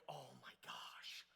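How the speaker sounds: slow, hushed whisper of disbelief; stunned whisper. Retold or imitated. Two friends seeing the shredded tire, stunned whisper. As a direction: breathe it out slowly, aghast.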